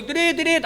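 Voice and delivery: high mock horn beeps; horn imitation. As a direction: toot like an impatient rider's horn.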